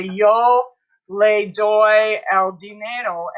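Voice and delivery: slow, spaced-out words; deliberate word-by-word teacher delivery; slow modelling. Shown as a demonstration. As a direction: give each Spanish word its own beat. Teacher builds Spanish sentence word by word.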